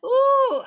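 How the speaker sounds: high shrieking laugh. Real, not acted. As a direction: burst into a shrieking cackle.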